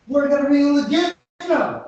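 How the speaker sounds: eager promise. Retold or imitated. Henry promises catchers the capture.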